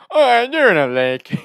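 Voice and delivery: high, very loud, drawn-out confession; hammed-up prank caller; cartoon prank voice. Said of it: "Jimmy character from South Park voice".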